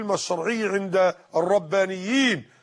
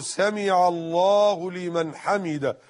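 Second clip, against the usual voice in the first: slow, every word drawn out and articulated; clear, distinct wording; slower than usual. Shown as a demonstration. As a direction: recite it slowly so each word stands clear. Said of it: "ألفاظه كده مفسرة وواضحة". (ببطء، بألفاظ مفسرة وواضحة)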